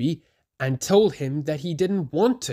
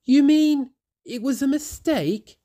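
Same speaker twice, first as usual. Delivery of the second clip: higher, louder wondering; much higher surprised Thomas; surprised question; 12 semitones higher, 4 dB louder than usual. A narrator's reading. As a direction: realize with surprise. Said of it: "he asked".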